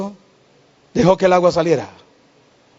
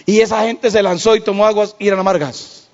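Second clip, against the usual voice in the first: ordinary talk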